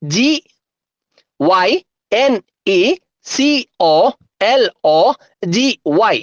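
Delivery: letter by letter with long pauses; slow, separated dictation of letters; slow spelling. Shown as a demonstration. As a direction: spell it out slowly, each letter standing alone. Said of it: "ഒന്നുകൂടെ സ്പീഡില്".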